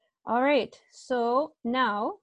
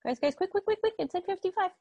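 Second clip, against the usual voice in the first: hurrying urgency; 8 semitones higher, faster than usual. Real, not acted. Teacher hurrying class before time.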